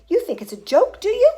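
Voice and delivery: high, loud indignant challenge; outraged. A narrator's reading. Mother confronting Wonka about her son, outraged. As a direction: challenge him loudly.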